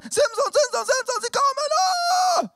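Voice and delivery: very high, loud, drawn-out cry of alarm; hammed-up shrieking alarm call; frantic calling out. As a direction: scream the warning, stretching the end. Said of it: "dann ruft sie die Philister".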